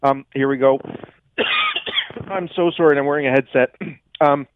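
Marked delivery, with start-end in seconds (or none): 1.4-2.2 throat-clearing cough; real cough into the mic. Real, not acted. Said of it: "frog in my throat".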